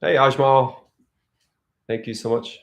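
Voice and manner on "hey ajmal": ordinary talk, nothing marked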